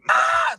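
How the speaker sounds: sharp high mock scream of pain; retold scream. Telling the family how Morgan bit him, a high mock yelp. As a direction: yelp it like you've just been bitten. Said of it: "I'm screaming, I'm yelling".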